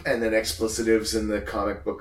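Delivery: ordinary talk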